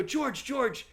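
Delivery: high, hurried shooing; telling a drunk friend to leave; urging friend away. Retold or imitated. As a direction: push him off gently but urgently. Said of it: "And then I go".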